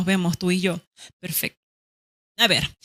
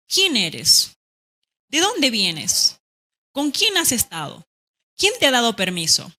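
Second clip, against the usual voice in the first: pointed questions, "¿Con quién has estado?" pitched higher; direct question intonation; 3 semitones higher than usual. Shown as a demonstration. Teacher demonstrating the intonation of direct questions. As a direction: interrogate like a suspicious parent. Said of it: "la entonación con la que yo pronuncio".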